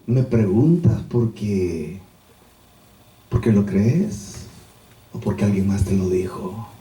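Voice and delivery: soft-edged, low, slow reply; meek and humble. Acted. Jesus answers Pilate a second time, meek, low and slow. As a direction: keep it humble and unhurried, pitched low. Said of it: "Su mansedumbre, su humildad".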